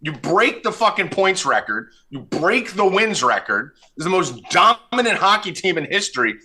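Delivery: high-pitched ranting tirade; mock outrage. Real, not acted. Host raging at co-host over the Bruins loss, pitch up. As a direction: rail at him over his team's collapse.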